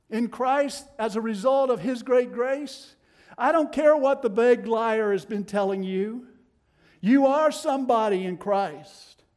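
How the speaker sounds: loud, rising preacher's cry; full-force preaching. Acted. Preacher thundering to the congregation, loud and rising. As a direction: build it to a ringing peak.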